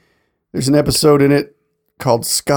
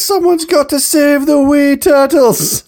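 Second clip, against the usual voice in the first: high, slow mock Scottish groundskeeper; Scottish accent; 13 semitones higher, 3 dB louder, slower than usual. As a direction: ham up a Scottish Willie voice. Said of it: "and he's like".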